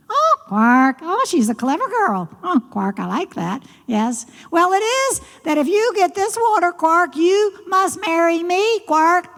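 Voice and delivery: high frog voice, rattling off terms; high-pitched, eager frog; gleeful frog character. Acted. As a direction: play a pleased frog laying down a condition.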